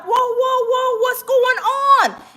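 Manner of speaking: high-pitched, alarmed crowd reaction; startled bystander. Retold or imitated.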